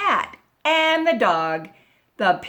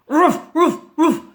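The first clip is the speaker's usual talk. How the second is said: short, loud, higher-pitched woofs; dog barking; 5 semitones higher, 8 dB louder than usual. A narrator's reading. Dog barks at the squeaky bed, loud woofs. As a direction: bark it out sharply like a dog. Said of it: "barked the dog".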